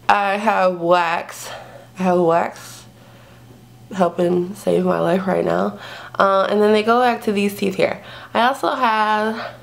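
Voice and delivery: talking with fingers in mouth; lip pulled down by fingers. Real, not acted. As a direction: lips held open. Seen 2: Fingers hold lower lip down, showing braces, throughout the words.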